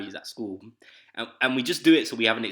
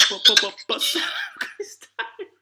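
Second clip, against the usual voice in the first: high laughing stammered excuse; giggling pleading schoolboy; laughing mimicry; 5 dB louder than usual. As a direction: mimic the kid while laughing. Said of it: "He's like".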